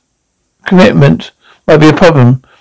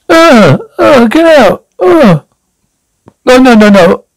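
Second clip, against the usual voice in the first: disgusted squeal; 8 semitones higher than usual. Real, not acted. Picturing mum naked, disgusted.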